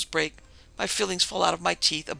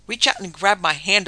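loud, insistent instruction; exasperated raised voice; urgent calling out; 3 semitones higher, 9 dB louder than usual. A narrator's reading. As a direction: call out loudly to your rescuer. Trapped Milton calls out instructions.

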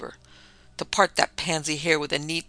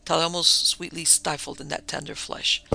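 ordinary delivery, nothing marked

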